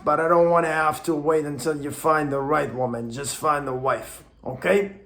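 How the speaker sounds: a light hint of an Italian gangster grandfather. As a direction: lean lightly into a mob-boss accent for grandpa. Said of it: "sound like an Italian gangster".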